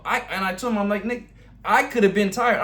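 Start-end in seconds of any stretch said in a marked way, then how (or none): 1.1-2.6 higher and louder, fired up at his buddy; fired-up retort. Retold or imitated. To his sparring partner after the round, fired up and loud. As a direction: snap back at him, all pumped up. Heated retelling of sparring talk.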